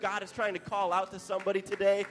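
preaching at full force. Acted. Sermon builds to climax.

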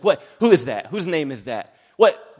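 loud confusion. Retold or imitated. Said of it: "someone was like".

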